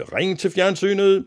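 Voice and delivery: high, loud, drawn-out call; shouted command. A narrator's reading. Mads claps and commands the telephone, shouting. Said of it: "og råbte".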